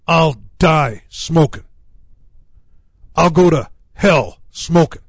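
a mild imitation of a furious smoker; retold angry defiance. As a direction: lean into his stubborn fury, slowly. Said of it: "He was mad".

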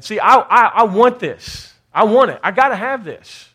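loud, insistent preaching bursts; fervent longing. Real, not acted. Preacher crying out for the blessing, fervent. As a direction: exclaim it with real hunger.

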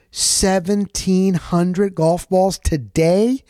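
slow, drawn-out rising disbelief; amazed repeat. Retold or imitated. Teller echoing the golf-ball count in slow disbelief. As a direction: stretch it out as if stunned by the number.

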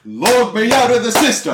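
high put-on echo; bar patron. Patron echoes the bard's song title, high-pitched. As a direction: call it back in a high, silly voice.

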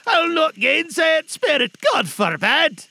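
very high, loud exclamation; Scrooge crying out. A narrator's reading. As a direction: cry out in fervent agreement with the spirit. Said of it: "cried Scrooge".

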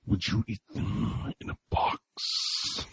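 slow whisper, laughing; whispered taunt. Acted. Dracula whispering a taunt to Sam. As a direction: hiss the offer with a chuckle.

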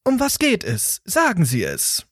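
mock robotic phone menu, lightly voiced; computer voice. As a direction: read it out like a stiff voice bot. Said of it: "eine Computerstimme".

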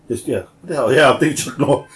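usual speaking voice

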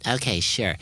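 ordinary delivery

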